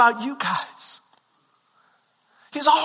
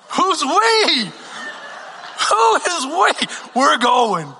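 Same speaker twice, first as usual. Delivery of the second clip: incredulous shout; 4 semitones higher, much slower than usual. Retold or imitated. Chris shouting who is we at mentor. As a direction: shout it in disbelief.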